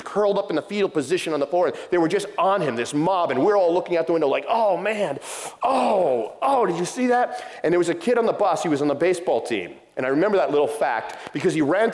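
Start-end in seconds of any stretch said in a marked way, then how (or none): 4.5-7.4 high, drawn-out "oh" of a shocked spectator; raised-pitch retold astonishment; gawking onlooker. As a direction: imitate kids reacting to a fight. Bus riders gawking at fight.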